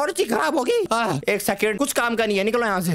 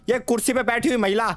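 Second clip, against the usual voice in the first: usual speaking voice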